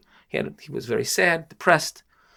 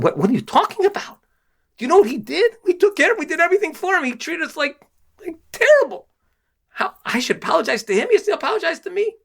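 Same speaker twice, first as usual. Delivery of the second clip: much higher, outraged protest; the host sputters in disbelief at the idea of apologizing; outraged indignation; 3 dB louder than usual. Retold or imitated. To his wife, host protests apologizing, outraged and much higher. Husband refuses wife's suggestion to apologize.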